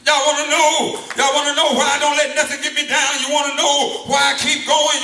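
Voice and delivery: usual speaking voice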